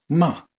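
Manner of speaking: MA falling from high; fourth tone. Shown as a demonstration. Teacher gives the word for scold, falling tone. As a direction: start "Ma" high and loud, then drop. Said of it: "ton qui part de très haut et qui tombe".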